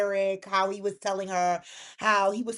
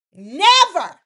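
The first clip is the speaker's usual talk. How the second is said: higher and louder, final and firm; emphatic refusal; 7 semitones higher, 12 dB louder than usual. Retold or imitated. Elektra refusing Will outright, loud and emphatic. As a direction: slam the door on him with one word. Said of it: "is what she tells him".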